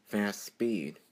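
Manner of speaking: usual speaking voice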